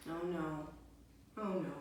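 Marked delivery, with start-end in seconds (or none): none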